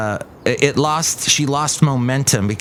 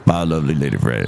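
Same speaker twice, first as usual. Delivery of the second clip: very low put-on farewell; visiting comic character; 11 semitones lower, faster than usual. Visitor parting from the co-host, very low character voice. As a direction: say goodbye way down in pitch. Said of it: "Look who else is here".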